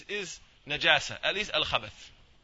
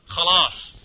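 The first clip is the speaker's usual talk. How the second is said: "Khalas" called out loud and firm; emphatic khalas; 12 dB louder than usual. Shown as a demonstration. Teacher calling out "Khalas" to show a finished thing, loud. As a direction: slam down "Khalas" like finishing something. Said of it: "we say what?".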